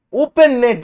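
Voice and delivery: "Open" hit high and loud; exasperated fan outburst; disbelief. Real, not acted. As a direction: cry out in disbelief. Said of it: "repeated in disbelief".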